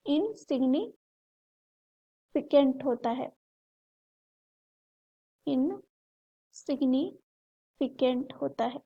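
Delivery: "Insignificant" drawn out slowly, broken into parts with pauses; slow emphatic repetition. Shown as a demonstration. Teacher repeating the key answer for students, slow and broken up. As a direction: dictate "insignificant" piece by piece, unhurried.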